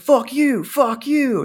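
imitating a man losing it at him; tripping out. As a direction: hiker cursing at the driver, mock furious yell. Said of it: "tripping out on me".

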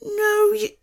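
ordinary delivery

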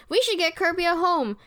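high-pitched mock kid voice; lightly acted high character voice; imitating Tiff. Recapping Tiff's idea to co-hosts, high imitation.

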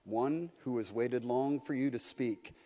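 lightly voiced great lion, speaking softly; deep quiet voice. The lion speaks quietly to frightened Shasta, a hint of a deep voice. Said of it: "Its voice was not very loud, but it was deep".

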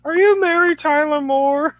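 high, loud, slow cartoon voice; hammed-up cartoon impression; Simpsons character voice. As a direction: call it out as a drawn-out cartoon question. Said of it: "I also thought of The Simpsons".

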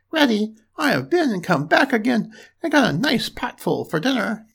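high storybook piglet voice; triumphant little pig. A narrator's reading. Plays the pig.

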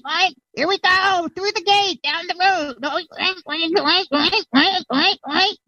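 high oinking pig voice; fleeing pig. Acted. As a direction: squeal out the escape plan between oinks. Player acting the fleeing pig.

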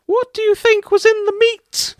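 a hammed-up squeaky villain line; high-pitched voice. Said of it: "in a high-pitched voice said".

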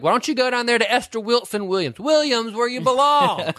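high, sneering voice; catty hooker. Retold or imitated. Retelling a hooker fight, high and sneering. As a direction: shoo a rival off the corner. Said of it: "She's like".

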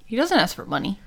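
ordinary delivery

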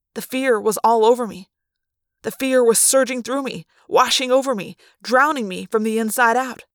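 usual speaking voice

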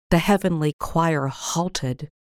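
ordinary delivery, nothing marked

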